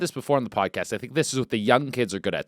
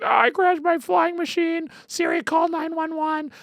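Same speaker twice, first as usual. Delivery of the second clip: much higher, squeaky imitation of the crashed guy; mock whiny victim. Retelling a viral clip, imitating the crashed pilot in a squeaky voice. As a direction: squeak it like a helpless man. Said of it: "he's like".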